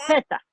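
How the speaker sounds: Z said as a lisped Castilian th; Spanish Z. Shown as a demonstration. Teacher showing the Z of Spain to the class. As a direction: lisp the Z like in Spain. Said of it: "en español de España, así".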